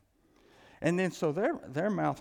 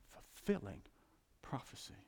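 whisper; 8 dB quieter than usual. Real, not acted. Hushed emphasis.

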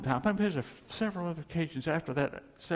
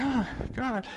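crying out; 9 semitones higher than usual. Retold or imitated. Crying out in desert.

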